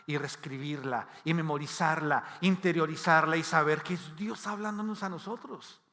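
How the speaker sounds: ordinary talk, nothing marked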